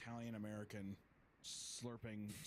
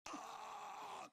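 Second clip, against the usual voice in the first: mock scream. People getting pepper in their eyes, mock screaming. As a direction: scream small like distant people. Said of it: "the people are screaming".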